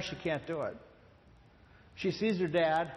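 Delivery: normal talking voice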